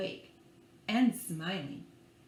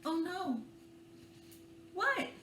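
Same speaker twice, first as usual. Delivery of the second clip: ordinary delivery, nothing marked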